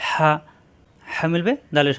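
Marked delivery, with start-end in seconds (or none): 0.1-0.3 হা with the H squeezed from mid-throat; pressed throat H. Shown as a demonstration. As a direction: push the start of হা through a constricted throat. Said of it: "কণ্ঠনালীর মাঝখানে চাপ দিয়ে". (হা কণ্ঠনালীর মাঝখানে চাপ দিয়ে)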